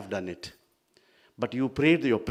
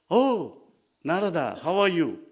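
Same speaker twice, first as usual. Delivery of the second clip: acted greeting; 6 semitones higher, 5 dB louder than usual. Mahavishnu greets Narada in story.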